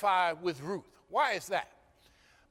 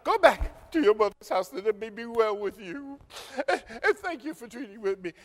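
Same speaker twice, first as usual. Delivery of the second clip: Naomi's voice; 3 semitones higher than usual. Acted.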